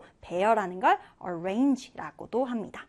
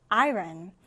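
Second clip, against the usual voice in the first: "iron" with the stress on I; stress on first syllable; 5 semitones lower than usual. Shown as a demonstration. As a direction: say "iron", leaning on the opening syllable. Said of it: "첫 음절에 강세를 두고". ("iron" 첫 음절에 강세)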